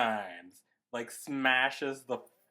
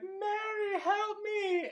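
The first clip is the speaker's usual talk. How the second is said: desperate cry; slower than usual. Retold or imitated.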